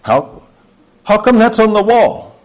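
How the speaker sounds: insistent mock toddler voice; imitating a toddler. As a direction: mimic a toddler who keeps on questioning. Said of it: "It comes out in toddlers".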